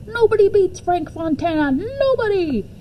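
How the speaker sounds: high, eager ad-slogan yell; yelling back. Retold or imitated. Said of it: "I yelled back".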